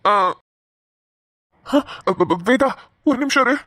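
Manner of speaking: halting, stammering unease; hesitant and rattled, with long gaps; flustered. Acted. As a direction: stall nervously, tripping over the first syllables. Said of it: "ஏன் நீங்க ஒரு மாதிரி பதட்டமாவே இருக்கீங்க".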